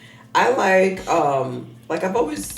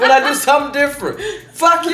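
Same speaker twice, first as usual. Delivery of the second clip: high, loud comic outrage; hammed-up defensive shouting at the kids; playfully outraged, voice pitched way up; mock indignant protest; 11 semitones higher, 8 dB louder than usual. Retold protest to her kids, continued.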